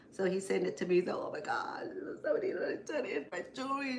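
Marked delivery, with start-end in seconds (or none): 1.3-4.0 fast, breathless whisper of disbelief; amazed whisper. Real, not acted. Seeing the photo of her returned necklace, in a fast amazed whisper. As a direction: whisper it as if you can't believe your luck.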